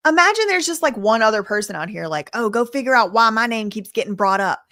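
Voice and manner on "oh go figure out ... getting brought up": ordinary talk, nothing marked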